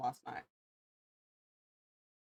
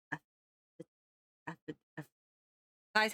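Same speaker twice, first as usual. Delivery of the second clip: ordinary talk, nothing marked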